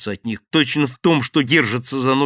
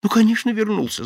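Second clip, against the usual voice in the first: eager mumbling; 10 semitones higher, 4 dB louder than usual. A narrator's reading. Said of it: "забормотал Шеренец".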